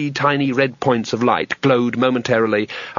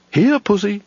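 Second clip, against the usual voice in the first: higher, sweet-talking call; coaxing; 8 semitones higher than usual. A narrator's reading. Caveman coaxes a sabre-toothed tiger, sweetly. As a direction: coax the beast like a house cat.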